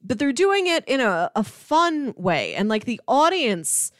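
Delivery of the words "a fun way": normal talking voice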